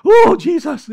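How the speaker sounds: high mock-elated whoop; hammed-up excited worshipper; jumping for joy. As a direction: act out bursting with joy about church. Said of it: "yung you're jumping for joy".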